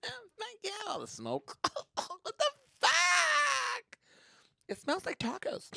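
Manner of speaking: put-on high, far-off valley girl; high disgusted girl voice; college girls. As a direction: gasp at the smoke.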